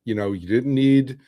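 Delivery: ordinary delivery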